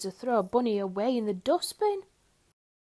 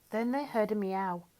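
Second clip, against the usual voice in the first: ordinary delivery, nothing marked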